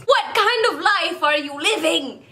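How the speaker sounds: high, grossed-out squeal; disgusted Edward. Retold or imitated. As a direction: recoil like something stinks. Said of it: "he's like".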